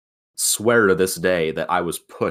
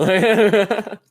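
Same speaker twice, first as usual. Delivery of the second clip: loud high call of a name, drawn out; 6 dB louder than usual. Real, not acted. Friend calling to Evan to go to sleep, loud high call. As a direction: call Evan's name across the room.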